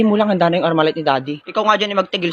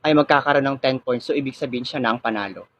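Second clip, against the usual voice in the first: composed teacher, low-pitched and softer; 6 semitones lower, 3 dB quieter than usual. Acted. Teacher opens the pronunciation quiz for the class, low formal voice. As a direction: address the class in a low, measured voice.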